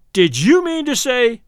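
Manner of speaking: loud, slow and high bullying. A narrator's reading. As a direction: push him around with the words. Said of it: "in a bullying tone".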